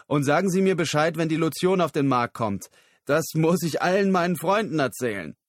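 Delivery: laut und höher hinterhergerufen; nachgerufen. Acted. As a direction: ruf es laut quer durch den Raum. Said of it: "rief Christopher ihm nach". (Christopher ruft Lyle laut seine Bitte hinterher)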